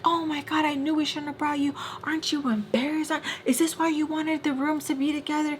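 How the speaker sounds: high-pitched mock scolding; retold going off. Friend going off about the rooms, high-pitched mock scolding. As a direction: imitate her tirade, pitch held up at normal volume. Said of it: "she's, like, going off".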